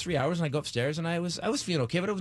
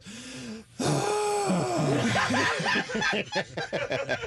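pointed sigh; 7 semitones higher than usual. Retold or imitated. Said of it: "She just walks by you and goes".

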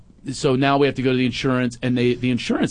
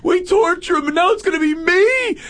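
put-on high, loud teen whine; whiny teen son; 4 dB louder than usual. Son dreading school drop-off, high whining shout. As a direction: whine like a mortified teenager.

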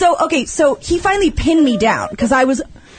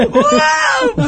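panicked yell. Retold or imitated. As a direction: yelp it like the car is spinning. Retelling freaking out in car.

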